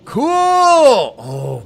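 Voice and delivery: loud, drawn-out high cheer; excited shout. Real, not acted. Streamer reacting to the game, thrilled shout. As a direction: holler it in delight, stretching the vowel.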